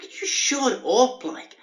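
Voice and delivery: imagined slow furious snap; furious retort. Retold or imitated. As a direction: spit it out slowly, barely holding back rage. Wants to snap at guy.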